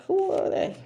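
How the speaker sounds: a high mock question from a puzzled townsperson; high squeaky who are they. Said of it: "They're like that, like".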